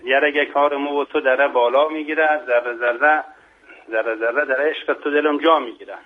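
rhythmic verse in Mashhadi dialect; Mashhadi accent. Shown as a demonstration. Guest reciting Bahar's dialect verse to the host, Mashhadi accent. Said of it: "با لهجهٔ شیرین مشهدی".

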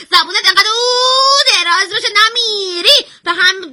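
high-pitched outburst with one long vowel; angry scolding. Acted. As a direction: berate the host, pitched high, stretching the vowel. Expert berates host in quarrel. (سرزنش با جیغ و داد)

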